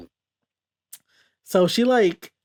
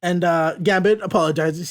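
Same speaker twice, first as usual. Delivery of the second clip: ordinary talk, nothing marked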